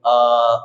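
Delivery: a long stretched vowel आ; teacher drawing out आ for students; long drawn aa. Shown as a demonstration. Teacher stretches आ for the class. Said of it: "खींचते हुए पढ़ा जाता है".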